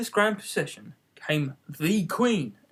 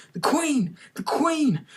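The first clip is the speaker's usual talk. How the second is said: high cries of alarm; alarmed high-pitched call; 10 semitones higher, slower than usual. A narrator's reading. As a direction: call out the warning to the others. Gardener calls out warning.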